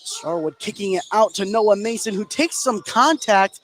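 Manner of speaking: live basketball call, building as the shot goes up; play-by-play. Real, not acted. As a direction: call the action as it happens.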